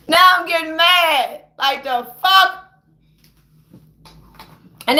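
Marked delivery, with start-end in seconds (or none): 0.1-2.6 slow, drawn-out rising anger; getting mad. Real, not acted. Ranter repeats it as the temper builds, getting mad. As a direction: stretch the words with mounting anger.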